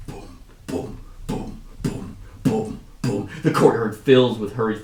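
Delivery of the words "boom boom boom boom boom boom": hushed, evenly spaced booms; imitated drum beats. Narrator sounding distant war drums, soft booming.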